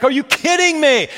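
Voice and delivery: high, loud thrilled disbelief; out of her mind. Retold or imitated. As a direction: imitate an overjoyed wife, voice leaping up. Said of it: "she's just out of her mind".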